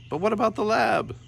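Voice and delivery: high, small pleading voice; tiny brother voice. A narrator's reading. Shrunken Yorick begging his brother for help, high and small.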